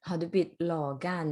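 Har du bytt lakan slowly, with the stress on bytt; slow repeat. Shown as a demonstration. Teacher repeating the clip line slowly for the class. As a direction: echo the clip word by word.

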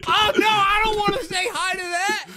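high, crying panic; terrified cry. Real, not acted. Gamer scared of the warden, crying out. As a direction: whimper in fear of the monster.

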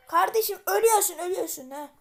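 high, loud warning yell at a mob; threatening shout. Real, not acted. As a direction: bellow that it's about to die. Threatens the mobs while fighting.

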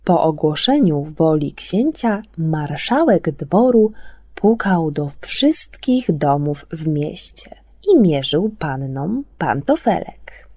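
usual speaking voice

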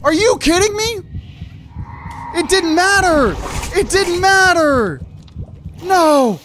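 high, loud furious yells of disbelief; frustrated screaming. Real, not acted. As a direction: scream out real rage at losing. Dies to the Ravager, loses loot.

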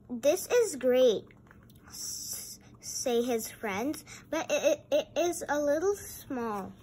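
giggly storytime voice; reading to children. Acted. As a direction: read it with a laugh in the voice. Reading aloud to toys.

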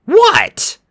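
high, loud, irritated yelp; annoyed. A narrator's reading. Annabeth shaken out of her game, loudly annoyed. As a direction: snap it, annoyed at being interrupted. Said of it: "She looked up annoyed".

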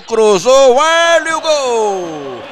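goal call. Real, not acted. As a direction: explode on the goal. Third goal called.

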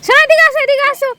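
loud, high call across the yard. Real, not acted. Calling child over from afar.

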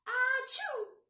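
big high sneeze; loud sneeze. Acted. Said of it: "echoed out of the den".

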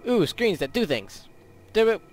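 normal talking voice

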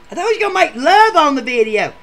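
high, loud protest; outraged loud whine; indignant shout. Retold or imitated. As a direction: yell your complaint at her. Ed protesting the date.

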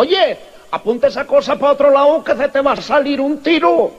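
high, urgent mock alarm; acted, agitated protest; alarmed warning. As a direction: warn a careless friend urgently. Man warns friend aiming gun.

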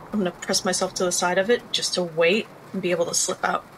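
ordinary talk

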